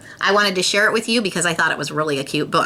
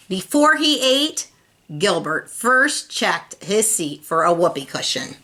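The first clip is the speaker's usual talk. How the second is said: ordinary delivery, nothing marked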